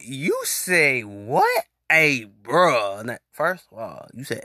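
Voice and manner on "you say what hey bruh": very slow, stretched you say what, rising; mock outrage, slowed right down; disbelieving you say what. Reacting to the DM, slow stretched disbelief. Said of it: "I'm sitting here like".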